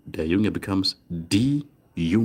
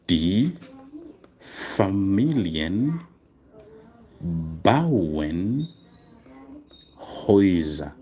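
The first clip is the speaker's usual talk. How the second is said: very slow, each word set apart by long pauses; slow dictation; much slower than usual. Shown as a demonstration. Teacher dictating to students while writing it down, very slow. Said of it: "I should write this one down for, uh, spelling".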